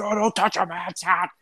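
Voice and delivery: high, strained cowboy yelling, broken by laughter; mock berserk outrage. Podcaster reenacting a wrestler defending his hat, high mock rage. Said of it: "he's just gone berserk".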